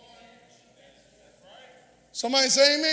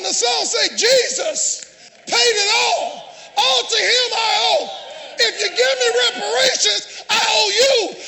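loud, raised preacher's cadence; full-force preaching; 5 semitones higher, 4 dB louder than usual. Real, not acted. Preacher rejecting reparations before the congregation, full-force preaching. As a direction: drive each phrase home to the congregation.

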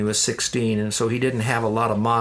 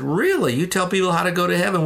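higher-pitched imitation of an amazed dad; surprised question; 6 semitones higher than usual. Dad hears son teaches about heaven, surprised question. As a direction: act out a dad's mild astonishment.

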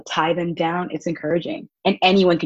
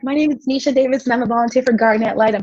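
flustered rushed intro; 5 semitones higher, faster than usual. Retold or imitated.

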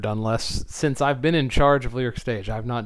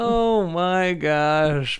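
long, drawn-out, raised exclamation; amazed disbelief; 6 semitones higher, 4 dB louder, much slower than usual. Real, not acted. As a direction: stretch it out in real astonishment. Amazed at having forgotten the concert.

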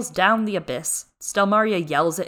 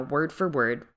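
normal talking voice

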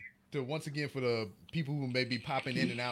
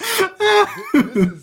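high, loud laughing; 15 dB louder than usual. Real, not acted. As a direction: laugh it out loudly. Laughing at friend's failure.

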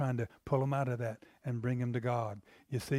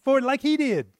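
pleading father; 12 semitones higher, 10 dB louder than usual. Retold or imitated. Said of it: "And the father goes".

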